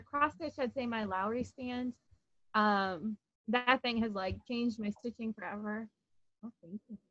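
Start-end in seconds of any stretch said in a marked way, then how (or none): none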